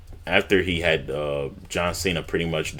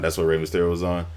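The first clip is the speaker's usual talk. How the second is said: ordinary delivery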